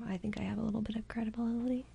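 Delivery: whispered, half to herself; a sly little whisper, almost unvoiced; whispered aside. Real, not acted. Joking aside about own credibility.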